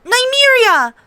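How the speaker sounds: high, loud sharp command; whip-crack call. A narrator's reading. Arya calls her wolf off the prince. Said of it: "Arya's voice cracked like a whip".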